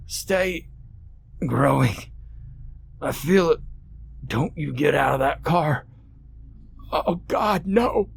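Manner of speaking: high strained gasping warnings; a man in agony begging her to stay; pained cries. A narrator's reading. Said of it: "Through his pained cries".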